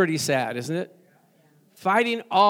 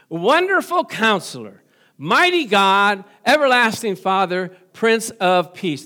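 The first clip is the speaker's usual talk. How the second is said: proclaiming; 6 dB louder than usual. Acted. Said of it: "here we go into the names".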